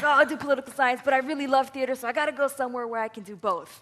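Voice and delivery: loud, high, gushing eagerness; eager teenage planning. Retold or imitated. Telling an audience about choosing colleges, hammed-up eagerness. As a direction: imitate an overkeen applicant thinking aloud.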